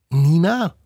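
high squeaky rising Nina; squeaky surprised question. Acted. Glider squirrel squeaks Nina's name in surprise. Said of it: "fiebt es laut und fragt dann".

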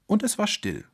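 ordinary delivery, nothing marked

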